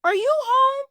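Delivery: very high, loud calling voice; high squeaky calling yell. A narrator's reading. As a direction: shout it up at the house. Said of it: "someone yelled from outside".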